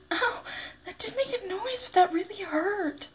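high pained yelp and wince; in sharp pain. Real, not acted. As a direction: cry out from a stinging smack.

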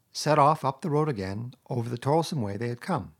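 usual speaking voice

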